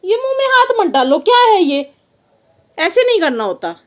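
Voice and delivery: high, loud sharp scold; startled mother's scold. Real, not acted. Mother scolding toddler at lunch.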